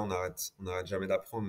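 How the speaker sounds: normal talking voice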